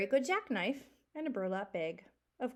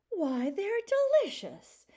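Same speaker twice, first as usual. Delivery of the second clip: mock yell; 11 semitones higher, 7 dB louder, slower than usual.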